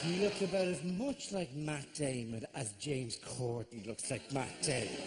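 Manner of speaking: ordinary talk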